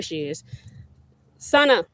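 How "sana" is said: loud, high call across the yard. Real, not acted. Mother calls her daughter over, calling out. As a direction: holler for someone to come over.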